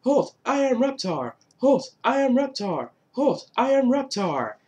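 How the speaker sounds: high, repetitive monster catchphrase; imitated cartoon proclamation on a loop; Reptar. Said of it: "all you kept hearing to end the episode off was".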